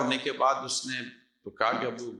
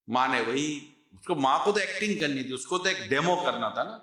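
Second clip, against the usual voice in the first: ordinary talk, nothing marked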